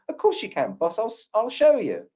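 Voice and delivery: a hint of a London Cockney spirit guide; friendly Cockney reassurance. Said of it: "in, a, a Cockney voice saying".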